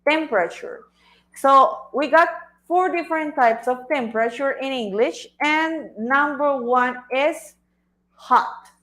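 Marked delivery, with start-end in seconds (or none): 0.0-0.8 Temperature with the weight on TEM; teacher stressing first syllable of Temperature. Shown as a demonstration. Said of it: "el acento de esa palabra está en la primera sílaba". 5.4-8.7 slow drawn-out build-up to hot; slow the reveal, then land on hot; teacher slowly revealing the first word; slow announcing. Shown as a demonstration. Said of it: "teacher announcing the first word".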